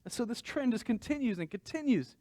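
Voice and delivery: usual speaking voice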